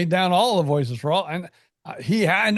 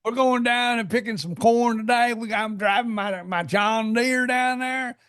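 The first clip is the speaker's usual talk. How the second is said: higher Southern twang, stretched out slow; country radio drawl; 4 semitones higher than usual. Acted. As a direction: lay on the country charm, unhurried. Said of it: "It's not like when I get on a country radio".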